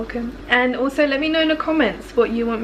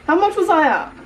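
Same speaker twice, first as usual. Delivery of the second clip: repeated louder and higher; 6 semitones higher, 4 dB louder than usual. Real, not acted. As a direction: say it again so she hears.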